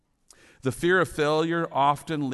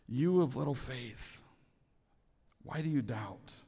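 much softer, tender correction; gentle, not railing; 7 dB quieter than usual. A narrator's reading. Jesus to sinking Peter, gentle and soft. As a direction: say it softly, the way a kind teacher corrects. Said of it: "Jesus wasn't railing on him".

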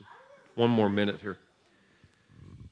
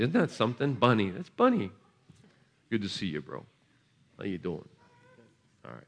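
normal talking voice

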